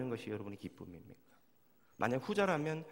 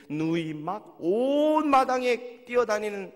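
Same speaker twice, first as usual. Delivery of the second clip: slow, higher, louder, drawn out; wonder; 7 semitones higher, 12 dB louder, much slower than usual. Real, not acted. Pastor describing a yard full of family, slow amazed voice. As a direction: linger in amazed delight. Vivid joyful vision.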